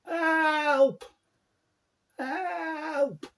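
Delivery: high, loud cries of Help; shouting for help. Retold or imitated. Man trapped by his trike yells for help. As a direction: call out desperately for help. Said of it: "I started to shout very loud".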